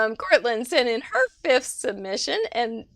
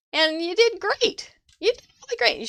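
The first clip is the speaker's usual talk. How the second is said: much higher, delighted encouragement; excited praise; 9 semitones higher than usual. Real, not acted. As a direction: lift the voice way up to praise. Praising the newcomer's card.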